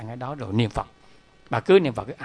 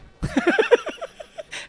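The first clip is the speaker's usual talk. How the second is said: high laugh; 3 dB louder than usual. Real, not acted. Laughs while telling the story.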